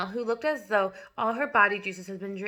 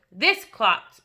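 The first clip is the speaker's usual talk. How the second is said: loud, scornful boom; 9 dB louder than usual. A narrator's reading. Headmistress booming at the boy before the school. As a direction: boom out the insult. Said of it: "boomed the headmistress".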